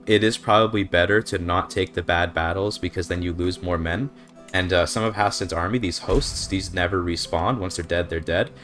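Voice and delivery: ordinary talk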